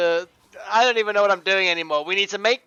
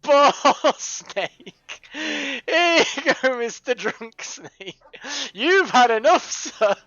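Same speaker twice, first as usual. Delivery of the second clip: mock caring carer; 6 semitones higher, 5 dB louder, slower than usual. King Mage coaxing the drunk snake back to bed, mock tender. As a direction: humour the drunk like a patient barman. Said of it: "King Mage is like".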